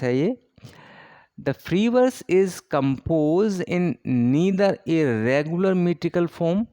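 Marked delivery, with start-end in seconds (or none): 1.4-6.7 very slow, word-by-word English reading for notes; dictating poets and dates to the class, very slow; slow dictation. Acted. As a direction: spell it out slowly so students can write. Dictates definition of free verse.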